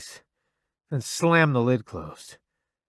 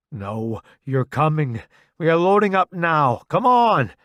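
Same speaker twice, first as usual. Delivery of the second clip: building from firm to loud; insistent rising shout; 5 semitones higher, 8 dB louder than usual. A narrator's reading. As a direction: push louder and higher with each line. Refusing friend's death plea.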